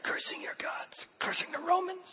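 strained, breathless near-whisper; barely enough air to get the words out; out of breath. Acted. Crucified man pushing up to talk, breathless and faint. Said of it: "If you talked, it would be".

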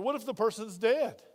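ordinary talk, nothing marked

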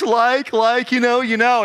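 light Valley Girl imitation; Valley Girl accent. If someone goes "like, you know", Valley Girl accent. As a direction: put on a Valley Girl lilt. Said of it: "Valley Girl".

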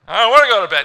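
put-on high, loud whining child; whiny kid. Child refusing bedtime, loud high whine.

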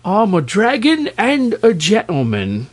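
high-pitched, dignified dragon; proud. A narrator's reading. Dragon answering the raging king, proud. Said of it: "answered the monster proudly".

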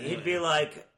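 ordinary talk

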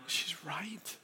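very soft, near-whispered realization; hushed inner prompting. Retold or imitated. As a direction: voice a quiet nudge of conscience. Holy Spirit's inner prompting voiced.

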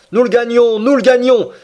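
high, loud triumphant shout; excited cry. A narrator's reading. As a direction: cry out as the prey comes within reach. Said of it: "s'écriait le Canadien". (cri de triomphe, aigu et fort)

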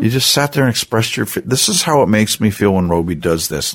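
put-on high, gushing praise for a child; enthusiastic praise. Dad praising Tori for staying calm, gushing and high. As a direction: pile on the praise, going overboard.

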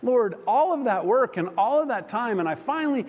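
high-pitched retold plea to God; bummed-out complaint. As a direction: voice climbing in exasperated recollection. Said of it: "I'm kinda bummed".

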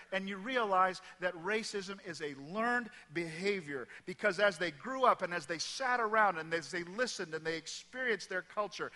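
ordinary talk

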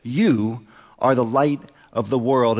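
slow, weighty stress on "You"; emphatic. Retold or imitated. Pastor quotes Jesus to the congregation, slow and emphatic on "You". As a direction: set "You" apart, then slowly finish the phrase. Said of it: "Jesus emphatically said".

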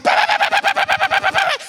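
very high frantic bahs; high mock gunfire, freaking out; panicked gun noise. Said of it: "I'm freaking out".

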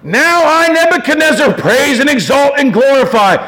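high, loud royal proclamation; exultant king's declaration; shouted praise. Acted. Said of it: "To shout out loud".

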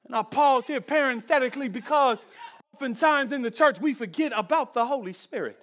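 loud, high, ringing preacher's cry; full-force preaching. Acted. Pastor preaching loudly to the church about the Spirit. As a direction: preach it out over the congregation.

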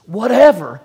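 Whatever punched loud and high; stress on whatever. Shown as a demonstration. Preacher showing the congregation the emphasis, Whatever stressed. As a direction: hit the word Whatever hard. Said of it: "The emphasis".

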